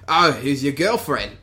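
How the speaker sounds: imitated Australian accent. Bounty hunter teasing Bosk as he passes, imitated Australian accent. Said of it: "his Australian accent".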